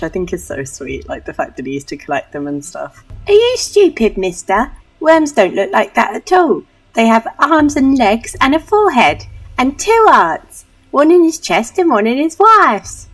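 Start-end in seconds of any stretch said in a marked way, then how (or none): 3.3-13.1 high, loud, scornful Cockney urchin. Acted. Street kid corrects the doctor about worms, loud Cockney scorn. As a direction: scoff loudly in hammed-up Cockney. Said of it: "a Cockney voice".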